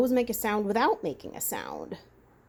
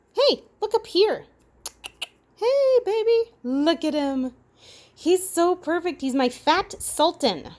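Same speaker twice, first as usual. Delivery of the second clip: much higher, louder cooing at the animal; 9 semitones higher, 5 dB louder, slower than usual. Real, not acted. Woman cooing at her pet while filming, high baby talk.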